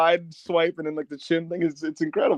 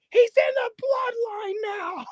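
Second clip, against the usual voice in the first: high, slow shrieked, near-crying scream; high stretched crying yell; screaming lady; slower than usual. Retold or imitated. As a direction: hysterical old woman wailing the line. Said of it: "the lady going".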